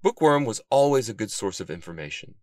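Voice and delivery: plain reading voice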